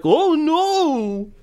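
very high mock cry of dismay; hammed-up game hero wail; Sora impression. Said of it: "then he'll be like".

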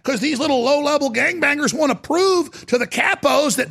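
ordinary delivery

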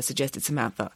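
plain reading voice

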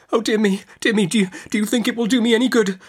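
high, flustered, hurried pleading; helpless cry. A narrator's reading. Mother asks whether tea will help, helpless. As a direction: plead helplessly, tumbling over the words. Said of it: "in a helpless manner".

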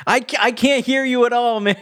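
high, quick mock holler; hurried strained call back; calling over noise. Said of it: "it's super loud".

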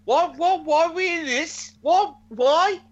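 high-pitched mock bewilderment; baffled protest. Fan quoting the wrestler put in the boot, high baffled voice. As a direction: imitate a wrestler squawking in confusion.

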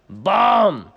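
loud, high shouted "bam"; imitated impact bang. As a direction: boom out the hit. Imitates broom hitting the face.

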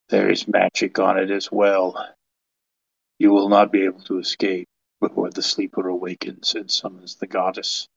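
measured, foreboding pronouncement; solemn warning figure. Acted. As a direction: deliver a grave warning, quietly sure. Game master voices a character's warning.

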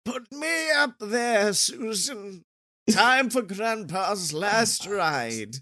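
put-on slow, frail old grandpa voice; a doddering grandpa begging for a ride; very old man. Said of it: "Just a very old man".

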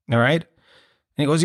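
ordinary talk

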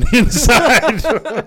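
laughing through the words; nervous giggle breaking into the line; nervous laughter. Real, not acted. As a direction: laugh helplessly at something grim. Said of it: "Except we're laughing nervously".

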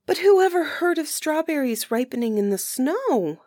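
high, incredulous exclamation; exclaiming. A narrator's reading. Girl to her sister, protesting in high disbelief. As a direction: lift it in disbelief. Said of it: "exclaimed Marukla".

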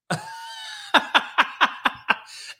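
high breathless burst of laughter; incredulous laugh. Real, not acted. Host laughing at what critics demand, incredulous. Mocking his own fake praise.

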